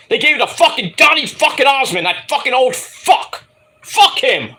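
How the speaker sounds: high, heated swearing; angry rant. Real, not acted. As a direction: vent the grudge. Rant about [name].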